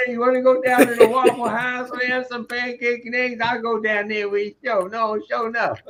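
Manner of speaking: hammed-up Southern accent inviting you out. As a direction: play a friendly Southern local in a Southern accent. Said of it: "I had a Southern accent".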